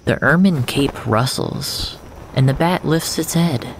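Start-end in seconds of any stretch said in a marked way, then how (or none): none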